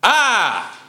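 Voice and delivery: a sudden high emotional cry of "Ah!"; demonstrated cry. Shown as a demonstration. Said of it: "I apologize in advance".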